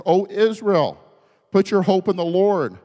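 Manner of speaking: high, loud ringing declaration; exultant proclamation. Acted. Psalmist giving his testimony to Israel, exultant proclamation. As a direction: proclaim it out to a whole nation.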